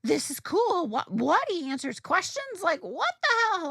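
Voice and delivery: high, punchy bursts of amazement; excited. Retold or imitated. Fan recalling the author answers questions, excited. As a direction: re-enact giddy fan disbelief, pitch jumping. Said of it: "I was excited".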